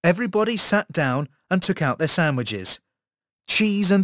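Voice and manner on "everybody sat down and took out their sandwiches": ordinary delivery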